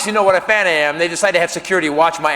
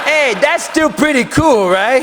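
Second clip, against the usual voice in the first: high, loud, drawn-out brave front; hammed-up upbeat shout; forced cheer; 7 semitones higher, 5 dB louder, slower than usual. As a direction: insist loudly that all is fine. Loud forced cheer after being punked.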